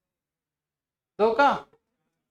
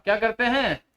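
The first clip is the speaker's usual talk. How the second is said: ordinary talk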